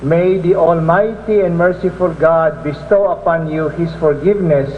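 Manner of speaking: ordinary delivery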